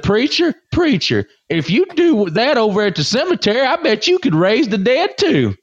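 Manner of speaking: put-on very high child's voice; little kid. Little kid speaking up to farting preacher, high-voiced. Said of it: "some little kid".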